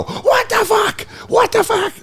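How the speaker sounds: very high, slow stunned cry; mock shock. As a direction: squeal it in total disbelief. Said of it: "and you go".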